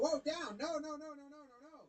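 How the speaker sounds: urgent insistent objection; excited protest. Retold or imitated. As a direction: pitch jumps up, rapid no's. Voicing batter demanding a challenge.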